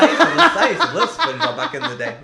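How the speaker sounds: giddy fan confession; excited. Shown as a demonstration. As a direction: gush it out with a laugh. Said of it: "repeats the line two ways".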